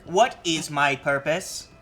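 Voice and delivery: slow, low robot voice; deadpan little robot; Butterbot robot voice. Pretend. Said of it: "You have Butterbot".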